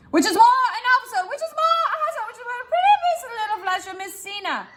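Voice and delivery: high, fast, building line; pompous Dogberry building up; crescendo. Shown as a demonstration. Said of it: "This is going to be my crescendo".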